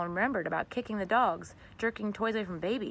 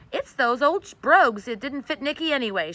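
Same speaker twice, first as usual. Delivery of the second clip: sobbing; 6 semitones higher, 9 dB louder than usual. A narrator's reading. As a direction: speak through sobs. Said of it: "she sobbed".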